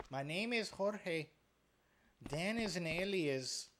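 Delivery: slow, lightly put-on Spanish accent; jokey Spanish accent reveal, drawn out; mock Spanish accent. Streamer joking to chat about his alias, light Spanish accent. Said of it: "I don't know why I'm doing that accent".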